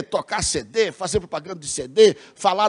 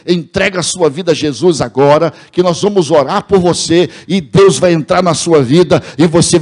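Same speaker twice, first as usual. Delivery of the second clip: loud, mounting radio altar call; imitating an evangelist's fervent, forceful appeal; full-force preaching; 8 dB louder than usual. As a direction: preach it louder and louder, driving every phrase home. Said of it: "e rasgava o verbo".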